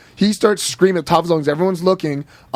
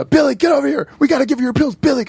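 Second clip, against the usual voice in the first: raised teacher's yell, higher; 8 semitones higher than usual. Retold or imitated. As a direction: call across the class. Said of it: "they'd yell and be".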